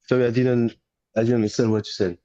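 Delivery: ordinary talk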